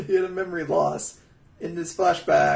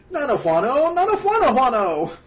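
high, loud nonsense chatter; playful mock gibberish in a raised voice; Sims gibberish; 8 semitones higher, 6 dB louder than usual. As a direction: imitate Sims game babble. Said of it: "this is a Sims quote".

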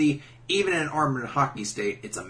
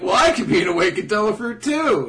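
higher, louder mock insistence; pleading forum player; 7 semitones higher, 5 dB louder than usual. Role-player demanding an awakened fruit, mock insistent voice. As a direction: imitate a pushy player wanting in. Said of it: "people who are like".

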